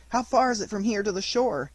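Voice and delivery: high, breathless question. Acted. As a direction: gasp out the question. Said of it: "asked Pinocchio breathlessly".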